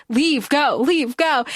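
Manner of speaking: ordinary talk, nothing marked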